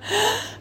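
quick high breath in before a sneeze; sharp high gasp. Acted. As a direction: gasp in sudden surprise.

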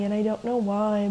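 ordinary delivery

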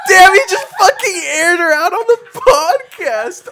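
high, loud laughing outburst; cracking up while yelling; laughing shout. Real, not acted. Friends roasting, loud laughter.

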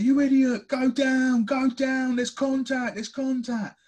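high, loud shouting at the telly, hammed up; mock furious fan yell. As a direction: bellow at the player to go down. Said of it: "you'd be like".

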